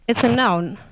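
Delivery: ordinary delivery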